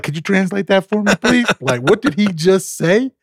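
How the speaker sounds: high-pitched comic bewilderment; retold exasperated confusion; baffled plea. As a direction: beg for a translation, voice way up. Baffled coach asking for translation, retold.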